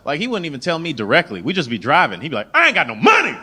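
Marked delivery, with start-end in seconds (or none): 2.5-3.3 sudden high, loud outburst about money; dad blurting out his money troubles in the car, loud and high; blurted complaint. Retold or imitated. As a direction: blurt it out of nowhere, louder and higher. Dad blurts it out while driving.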